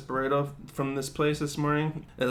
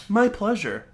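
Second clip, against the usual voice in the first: polite server; 6 semitones higher than usual. Retold or imitated. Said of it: "they say".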